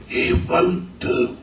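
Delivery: loud, slow theatrical whisper; imitated stage whisper. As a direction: mimic a whispered aside to a colleague, drawn out. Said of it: "whispered in his ear".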